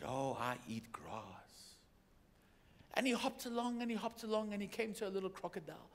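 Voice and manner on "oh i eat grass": low, slow, lazy hippo. Acted. Hippo answers the frog in a low, slow voice. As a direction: let the big hippo rumble it out slowly. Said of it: "And the hippo said".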